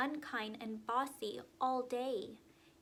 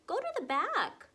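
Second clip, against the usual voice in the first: high, loud scolding; bossy scolding; 9 semitones higher, 9 dB louder than usual. A narrator's reading. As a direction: order him to the back sharply. Emily scolds pushy Connor.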